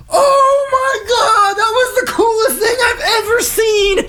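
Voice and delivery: very high, loud, slow shriek of delight; amazed shout. Retold or imitated. His own reaction to the Marine, shrieking amazed delight. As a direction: scream it like the best thing ever, half laughing. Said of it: "I was just like".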